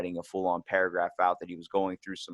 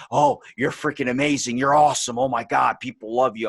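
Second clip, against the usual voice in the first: louder gushing praise; flattering inner demon; 2 semitones higher, 6 dB louder than usual. Retold or imitated. Inner demon flattering the speaker after compliments, gushing and louder. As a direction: hype yourself up like a tempting little demon. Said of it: "little demon I got in me that's like".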